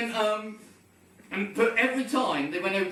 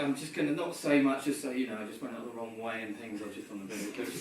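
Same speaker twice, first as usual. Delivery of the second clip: casual understatement; 9 semitones lower, 6 dB quieter, faster than usual. Retold or imitated.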